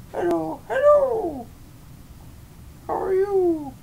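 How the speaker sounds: chirpy little puppet greeting; high puppet voice. Pretend. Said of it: "do a puppet show".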